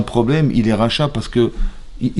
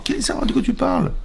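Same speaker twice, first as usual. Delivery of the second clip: réplique d'escroc; 12 semitones higher, 3 dB quieter than usual. Retold or imitated. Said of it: "Je dis, mais Yitzhara".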